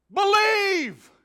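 huge high shouted command. Retold or imitated. God shouting down from heaven to believers. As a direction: bellow it down from heaven. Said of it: "he shouts out".

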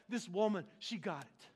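ordinary talk, nothing marked

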